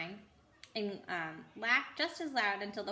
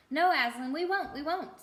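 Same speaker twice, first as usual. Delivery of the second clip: eager chorus; 6 semitones higher, 5 dB louder than usual. A narrator's reading. Crowd of animals answering.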